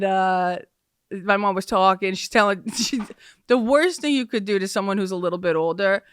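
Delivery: ordinary talk, nothing marked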